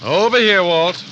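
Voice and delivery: loud, high call across the yard. Acted. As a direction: shout to be found. Calling to lieutenant after shots.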